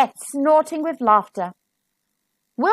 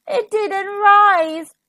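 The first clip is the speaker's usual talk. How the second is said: drawn-out, high-pitched groan of dismay; storybook child groaning in disappointment; 8 semitones higher, much slower than usual. A narrator's reading. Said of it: "groaned Wilf".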